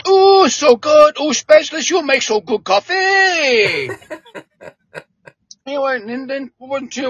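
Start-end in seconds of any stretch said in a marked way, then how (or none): 0.0-3.9 slow, high, delighted Cajun drawl; Cajun accent. Retold or imitated. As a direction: savor every word like a happy Cajun. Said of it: "well, he was a Cajun".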